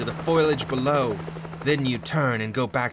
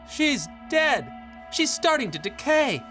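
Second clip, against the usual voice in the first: high-pitched cry of horror; horrified realization; 14 semitones higher than usual. Acted. Husband realizes wife is decaying.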